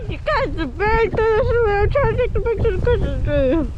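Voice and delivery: loud and high over the wind; shouting. Real, not acted. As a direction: call out over the storm.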